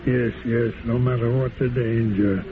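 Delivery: ordinary delivery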